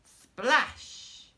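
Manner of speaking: splash sound. A narrator's reading. Sound word in story.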